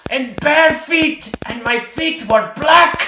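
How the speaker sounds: put-on high, slow German accent; drawn-out hammy German voice. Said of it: "I wish I knew how to do a German accent".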